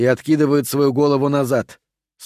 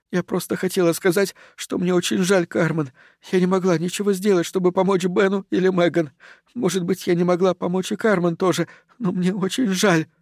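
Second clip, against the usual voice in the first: high, subdued, close to tears; tearful apology; 5 semitones higher than usual. A narrator's reading. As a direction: apologise high and small, as if crying. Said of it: "Кристи начинает плакать". (плачущий, виноватый высокий голос)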